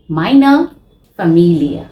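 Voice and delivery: slow, clear German for learners to repeat; German model words. Shown as a demonstration. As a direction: say Meine Familie as a teacher model. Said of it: "Please repeat after me".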